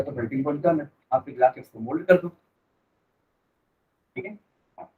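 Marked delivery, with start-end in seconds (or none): none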